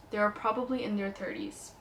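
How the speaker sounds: normal talking voice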